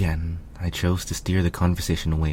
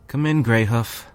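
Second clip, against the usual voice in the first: raised voice, welcoming; calling out; 6 semitones higher, 7 dB louder than usual. Acted. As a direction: call loudly through the door. Calling through door.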